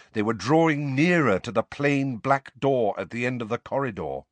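ordinary delivery, nothing marked

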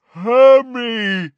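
high, very loud giant roar; giant's roar. A narrator's reading. Giant calling the girl, full roar. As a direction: roar the name out long. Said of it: "roared Grawp".

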